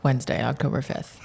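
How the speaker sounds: loud, punchy news-podcast host intro; radio voice. Shown as a demonstration. Guest tries his own show intro in a daily-news podcast voice. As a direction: announce each phrase firmly, with pauses between. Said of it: "Let me try mine now".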